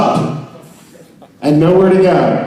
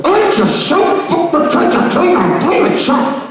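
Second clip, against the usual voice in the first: high put-on voice; 10 semitones higher, slower than usual. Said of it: "I used to go".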